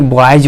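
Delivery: बॉयज ending in a voiced Z/J; बॉयज with the plural S said as Z; final Z sound. Shown as a demonstration. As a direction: end बॉयज on a buzz, not S. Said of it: "क्या बोलेंगे".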